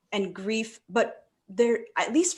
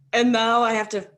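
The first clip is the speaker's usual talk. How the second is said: higher, louder whiny complaining; exasperated protest; 4 semitones higher, 6 dB louder than usual. Retold or imitated. Grieving speaker mock-protesting to herself, higher and louder. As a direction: protest like a dragged-along kid. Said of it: "Come on, man".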